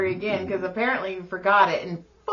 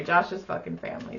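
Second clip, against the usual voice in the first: ordinary talk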